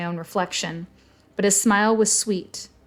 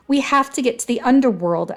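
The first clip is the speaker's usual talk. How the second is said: louder, higher, urgent insistence; pressing demand, voice raised; insistent; 5 semitones higher, 5 dB louder than usual. A narrator's reading. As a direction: insist on it firmly. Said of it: "I insisted".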